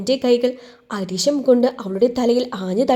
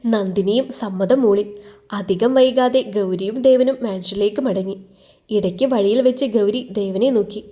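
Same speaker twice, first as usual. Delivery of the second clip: usual speaking voice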